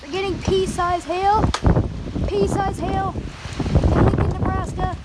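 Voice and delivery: high, loud call over the hail; shouting over storm. Real, not acted. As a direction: shout to be heard over the noise.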